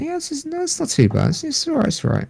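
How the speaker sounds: high, soft, reluctant mock agreement; begrudging squeak. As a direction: squeak it out grudgingly. Grudging high squeaky retold reply.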